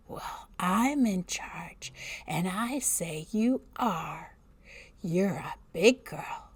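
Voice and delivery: put-on slow, cold babysitter whisper; bully the child in a hiss; menacing whisper.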